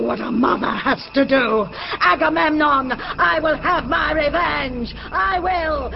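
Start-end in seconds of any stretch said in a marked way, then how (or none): 2.0-5.9 high shrieking vow of revenge; seething shrieking spirit. Acted. Mother swearing revenge.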